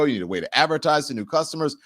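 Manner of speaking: ordinary talk, nothing marked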